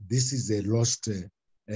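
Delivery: ordinary talk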